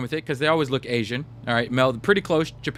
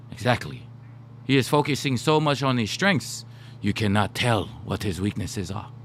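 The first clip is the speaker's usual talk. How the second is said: put-on Japanese accent, dropping low at the end; 3 semitones lower than usual. Coach explaining focus on strengths, put-on Japanese accent. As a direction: make the point in an imitated Japanese accent. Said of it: "I'll do my Japanese accent".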